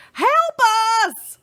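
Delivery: high desperate cry for help; calling for help. Acted. Missus Watson calling out for help. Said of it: "Missus Watson called".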